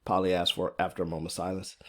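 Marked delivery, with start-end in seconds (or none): none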